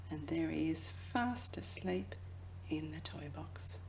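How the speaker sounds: soft whisper so the cat keeps sleeping; hush, he's sleeping. Acted. Reader to child, cat asleep in the toy box, whispering.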